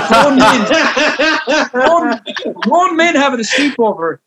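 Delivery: laughing through the words. Real, not acted. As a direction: chuckle while saying it. Laughing at own story.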